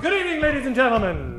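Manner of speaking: normal talking voice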